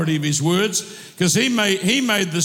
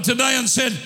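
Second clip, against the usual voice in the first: ordinary delivery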